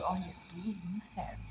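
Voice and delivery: hushed, breathy aside about the heat; whispered aside. Real, not acted. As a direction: whisper it like a confession. Hellish heat confided.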